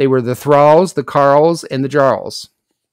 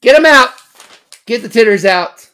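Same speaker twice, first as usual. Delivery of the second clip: mock shout; 7 semitones higher, 4 dB louder than usual. Jokey callout.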